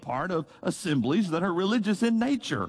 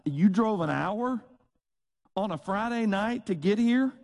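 retold disbelief, pitched up; mild amazement; 7 semitones higher than usual. Preacher retelling his reply to visitors who drove far, amazed. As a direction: question it in mildly incredulous, higher tones.

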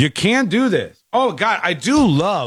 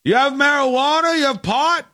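put-on higher, woozy voice of a drunk asking around; imitating the drunk passenger pleading, pitched up; 9 semitones higher than usual. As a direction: ham up a sloshed guy begging for pot. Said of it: "And he goes".

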